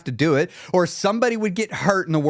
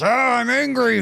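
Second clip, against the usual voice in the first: mock angry; 8 semitones higher than usual. Speaker acting out wanting to break a rule, mock angry. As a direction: whine up into an angry outburst. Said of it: "when I'm getting that just".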